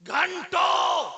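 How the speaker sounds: very high, very loud, very slow shout; a preacher shouting about hours of torment, very high and loud; drawn-out shout. A narrator's reading. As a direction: stretch the word into a long shout.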